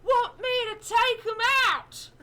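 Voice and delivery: put-on very high, loud and slow kid voice; eager urchin offer. Dodger asks the player if he should take them out, high and loud. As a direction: stretch it out high like a keen little thug. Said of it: "he's just gonna say".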